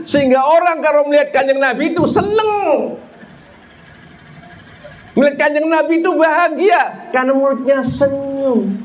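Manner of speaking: high-pitched, sustained preacher's delivery; impassioned preaching. Acted. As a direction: preach it high to the whole congregation. (ceramah berapi-api dengan nada tinggi)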